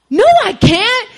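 high, loud, drawn-out wail; desperate refusal. Retold or imitated. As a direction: cry it out like you're about to burst.

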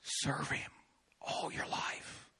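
breathy whispered voice in his head; hushed inner voice, slow and unvoiced. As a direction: whisper it like a voice only you hear. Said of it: "And he was like".

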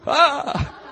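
high frightened yelp; short comic shriek of fright; mock startled scream.

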